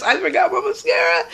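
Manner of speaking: a squeal of real-feeling dismay at the memory; shocked outcry. Retold or imitated. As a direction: cry it out in mock horror. Retold shock at forgetting.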